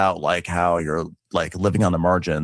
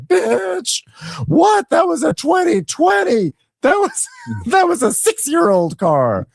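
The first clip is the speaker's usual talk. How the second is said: very high, loud, slow outraged falsetto; hammed-up diva fury; mock angry yell; 4 dB louder, slower than usual. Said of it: "that's what really gets Angela mad".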